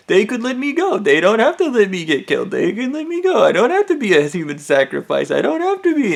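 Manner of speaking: high, pleading voice on the edge of breaking. Retold or imitated. Girl pleads that she needn't be a sacrifice, voice breaking. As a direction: beg them to let you go, close to tears. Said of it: "I start seeing her breaking".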